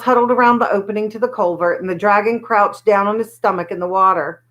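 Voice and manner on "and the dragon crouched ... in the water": ordinary delivery, nothing marked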